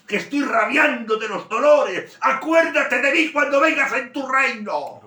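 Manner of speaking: very high, loud, slow anguished cry; desperate shout. Acted. Dying thief begs Jesus to remember him, anguished shout. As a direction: cry it out in agony, stretching every word.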